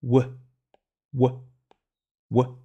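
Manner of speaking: "ouah" as a short clipped wa; wa sound. Shown as a demonstration. Teacher to pupils, drilling the letter's sound, short "ouah". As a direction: repeat "ouah" crisply three times. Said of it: "il fait le son ouah".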